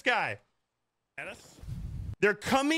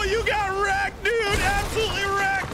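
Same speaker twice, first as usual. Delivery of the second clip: high, excited taunt; gloating shout; 7 semitones higher, 4 dB louder than usual. Real, not acted. Player taunting an opponent who got wrecked, gloating shout. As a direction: crow at the beaten player.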